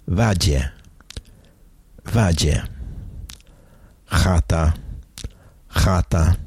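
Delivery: each Polish word said twice, slowly and distinctly, for learners to repeat; teacher modelling Polish nouns one by one with pauses; careful Polish word reading. Shown as a demonstration. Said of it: "as I read the Polish words".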